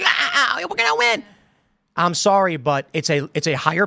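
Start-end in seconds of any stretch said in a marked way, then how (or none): 0.0-1.2 high mock rally scream; high hammed-up victory yell; mock AOC scream. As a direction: shriek it like a fist-pumping rally cheer. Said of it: "screaming like".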